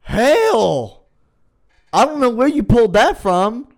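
high, loud disbelief; astonished. Real, not acted. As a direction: react to a surprise song in amazement. Shocked by friend's singing.

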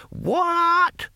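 very high, drawn-out querying screech; deaf old man. A narrator's reading. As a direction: squawk it like someone hard of hearing. Deaf old barbarian bellows a question.

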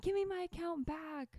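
high mock demand; mock angry demand. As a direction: pretend to bark at the hacker. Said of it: "threatening a hacker".

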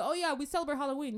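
high, loud, quick and chirpy; mock enthusiasm. Churchgoer telling a newcomer about Halloween, mock enthusiasm. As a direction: gush it like an eager churchgoer.

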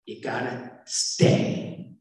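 unvoiced, slow whisper of advice; hushed advice. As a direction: breathe it slowly like a secret that matters. [name]'s earnest final advice.

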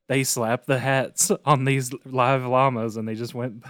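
ordinary delivery

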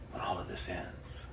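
hushed, breathy whisper; softly whispered opening. Real, not acted. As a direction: whisper it like a secret.

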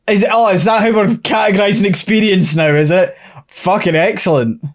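high sarcastic disbelief; sarcastic outrage. Real, not acted. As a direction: scoff at it, incredulous.

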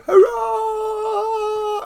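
high, long cheering call; kids' cheer. Retold or imitated. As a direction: lead the children in a big hurrah. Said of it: "got all the kids to go".

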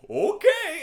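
very high, loud, cheery okay; oblivious friend. Acted. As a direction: chirp it like a clueless sidekick. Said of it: "he's just like".

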